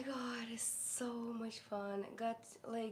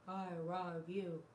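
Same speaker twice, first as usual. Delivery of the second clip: dog's voice; 5 semitones lower, 3 dB quieter, slower than usual. Pretend. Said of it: "You're gonna say, "I love you"?".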